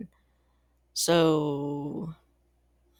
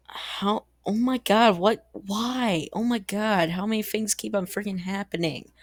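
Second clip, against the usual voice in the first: high, exasperated oh my god; overwhelmed outburst of disbelief; 6 semitones higher than usual. Real, not acted. Reacting to sudden war news.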